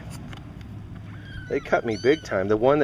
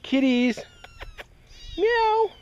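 high cat call; much slower than usual. Real, not acted. Calling kittens, imitating a cat.

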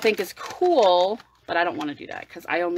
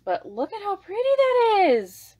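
high, louder, delighted; 12 semitones higher, 5 dB louder than usual. Real, not acted. Showing swatches to viewers, high delight. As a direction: show it off thrilled.

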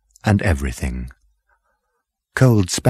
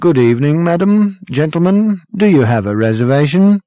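loud, higher-pitched officious waiter; formal waiter; 9 semitones higher, 6 dB louder than usual. A narrator's reading. Waiter asks new arrivals for reservation, formal. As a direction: greet with stiff professional politeness.

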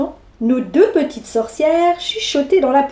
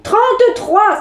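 irritated; 6 semitones higher, slower than usual. Acted. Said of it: "s'énerva le docteur".

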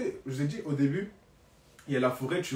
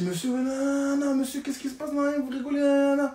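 begging; 9 semitones higher than usual. Retold or imitated. Begging the laughing driver.

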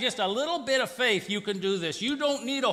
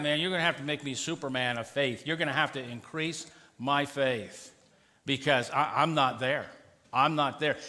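mock sarcastic disciple; 9 semitones lower, 3 dB quieter than usual. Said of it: "the disciples are being sarcastic".

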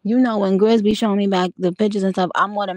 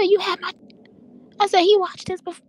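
incredulous scold; 13 semitones higher than usual. Retold or imitated. Telling her husband the baby was scared, high incredulous scolding. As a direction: play her own outraged reply to the husband.

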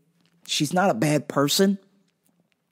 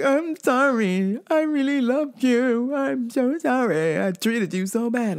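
higher, slow, mock-tearful apology; crocodile tears; 7 semitones higher, slower than usual. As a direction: ham up a fake weepy sorry. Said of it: "The crocodile fucking tears came".

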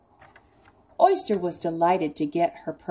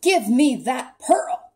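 loud, raised-pitch mock roar; roared demand; 6 semitones higher, 7 dB louder than usual. A narrator's reading. Eel chasing Octopus for the pearl, roared demand. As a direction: roar it like a storybook villain. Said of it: "roared the eel".

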